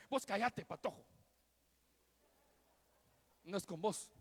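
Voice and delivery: put-on loud, snapping at a child; hammed-up angry father telling off his son; angry outburst. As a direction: bark it at the kid to shut him up. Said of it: "Fácilmente la hubiera podido caer".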